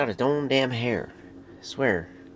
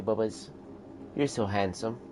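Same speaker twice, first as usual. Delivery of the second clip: ordinary delivery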